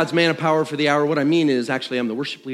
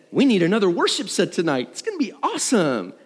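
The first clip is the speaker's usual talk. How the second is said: hyped-up organizers; 8 semitones higher than usual. Retold or imitated. Organizers hyping extra set.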